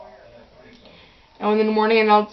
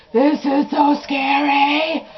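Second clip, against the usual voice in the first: scared; 4 semitones higher, slower than usual. Retold or imitated. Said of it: "I was like".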